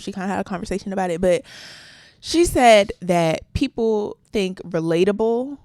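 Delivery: normal talking voice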